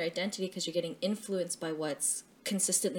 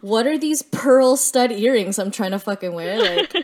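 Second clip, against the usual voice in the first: exasperated self-quote; 3 semitones higher, 11 dB louder than usual. Retold or imitated. Woman mocking her past self, loud and laughing. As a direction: blurt it out like a sudden realisation. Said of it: "like, "Oh shit".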